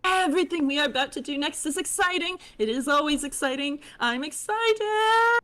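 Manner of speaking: high, fast eager chatter; high fish girl voice. Acted. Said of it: "this voice more distinct".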